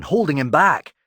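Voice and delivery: ordinary delivery, nothing marked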